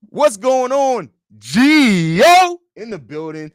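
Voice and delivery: loud, high, stretched-out greeting; hyped shout-out. Real, not acted. Host greeting a viewer joining the chat, hyped holler. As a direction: holler the name, drawing it way out.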